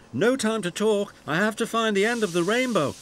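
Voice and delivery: high, hurried and eager; single-minded, busy engine; puffing little engine. A narrator's reading. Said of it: "Thomas puffed".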